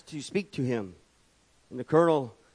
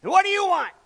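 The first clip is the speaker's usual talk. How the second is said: much higher, louder bark at the airman; barked question; 5 dB louder, faster than usual. Retold or imitated. Colonel barking at the airman who came in, much higher and louder. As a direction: snap it at him like a pompous colonel. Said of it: "he barked".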